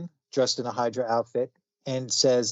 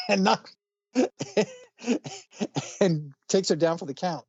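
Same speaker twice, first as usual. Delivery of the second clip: ordinary delivery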